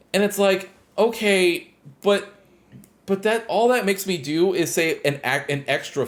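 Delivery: high, loud exasperated outburst; annoyed rant. Real, not acted. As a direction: let the frustration burst out, voice shooting up. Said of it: "the only thing that pissed me off".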